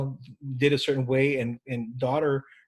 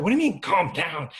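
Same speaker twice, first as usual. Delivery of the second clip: acting an indignant spouse, raised pitch; mock-upset retort, a bit louder; 4 semitones higher, 4 dB louder than usual. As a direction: throw it back, offended and higher. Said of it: "this person gets upset".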